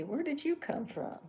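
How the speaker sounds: high, loud surprised voicing; startled cartoon character question; Chuck's line. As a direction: test the character's reaction out loud. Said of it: "He could say".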